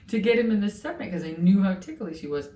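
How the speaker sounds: ordinary talk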